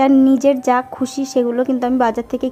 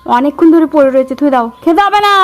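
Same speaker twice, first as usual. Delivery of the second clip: loud, high scolding; angry scolding; 4 semitones higher, 6 dB louder than usual. Real, not acted. Mother scolds, snatches food away. (রাগী বকুনি)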